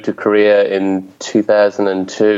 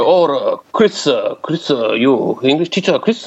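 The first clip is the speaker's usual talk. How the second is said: Korean principal's broken English; imitated Korean accent; 3 semitones higher, slower than usual. Korean boss greeting his new English teacher, imitated Korean accent. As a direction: imitate a Korean man hesitantly speaking English. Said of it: "So he's like".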